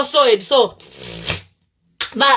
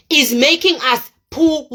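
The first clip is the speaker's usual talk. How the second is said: slow emphatic repeat; 3 semitones higher, slower than usual. Real, not acted. Vlogger repeats warning about big funerals, slow and emphatic. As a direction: stretch "poor" out slowly.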